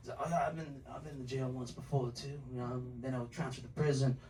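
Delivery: very low, soft tough-guy mumble; low-voiced ex-con. Friend swapping jail stories, low soft ex-con voice. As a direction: recall prison time low and cool.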